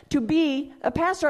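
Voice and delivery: normal talking voice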